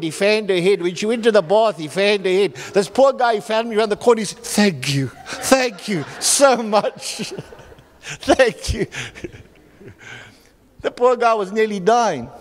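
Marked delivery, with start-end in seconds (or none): 4.4-8.9 laughing through heartfelt thanks; overcome, laughing his thanks; grateful laughing thanks. Retold or imitated. Relieved husband thanking the pastor, laughing gratefully.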